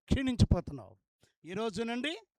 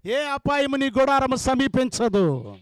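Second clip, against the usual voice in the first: loud proclamation; 11 dB louder, slower than usual. Acted. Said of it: "గట్టిగా చెప్పాలా?".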